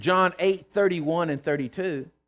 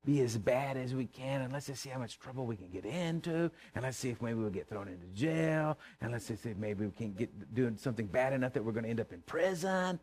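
much softer, a hushed lightly voiced plotting; quiet mock scheming; 5 semitones lower, 8 dB quieter than usual. Preacher imitating boys planning trouble, hushed scheming. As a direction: lightly voice the mischief. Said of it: "and saying".

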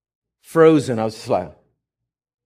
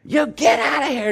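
rebuking demon; 12 semitones higher than usual. Retold or imitated. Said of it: "and so I said".

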